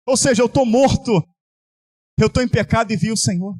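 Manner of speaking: normal talking voice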